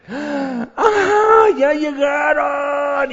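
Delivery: high, shrieking cry of joy; overjoyed grandma cry. Acted. Grandmother greeting her granddaughters, a high joyful shriek. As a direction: squeal with delight as the grandkids arrive.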